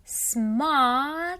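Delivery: "Смотреть" drawn out loud as smaaa-TRET; slow demonstration. Shown as a demonstration. Teacher introducing the verb to watch, "Смотреть" slowly sounded out with A. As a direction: stretch the A sound in "Смотреть", then the stressed second syllable. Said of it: "We read O like R".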